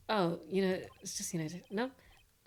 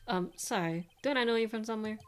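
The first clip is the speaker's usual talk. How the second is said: usual speaking voice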